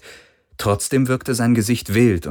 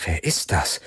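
softer, low and wary, almost a whisper; suspicious whisper; 3 semitones lower, 5 dB quieter than usual. A narrator's reading. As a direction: whisper it, not trusting him. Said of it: "flüsterte Kirai misstrauisch".

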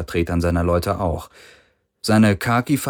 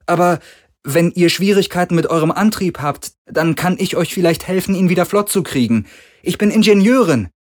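much higher woman's voice, eager to help; young engineer woman; 10 semitones higher, 4 dB louder than usual. A narrator's reading. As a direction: offer your help brightly. Kowalski offers help.

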